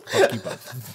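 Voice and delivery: laughing while swearing; jokey laughing insult; laughing mock insult. Real, not acted.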